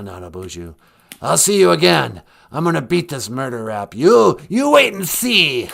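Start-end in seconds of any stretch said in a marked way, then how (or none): 1.2-5.7 raspy mock threat, raised and loud; Alec Baldwin. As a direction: threaten like a creepy murderous celebrity. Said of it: "a murderous rasp to his voice".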